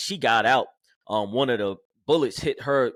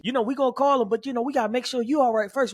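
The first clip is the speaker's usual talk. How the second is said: country sergeant; 12 semitones higher than usual. Retold or imitated. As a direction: play the big country sergeant reassuring him. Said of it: "this big country guy".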